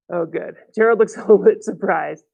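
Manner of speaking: usual speaking voice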